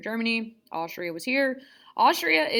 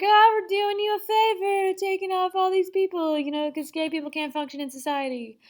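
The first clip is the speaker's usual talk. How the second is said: high, sing-song mock innocence; mock self-justification; 9 semitones higher than usual. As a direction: sound falsely helpful, pitched well up and easing down. Mocking killers' self-justification.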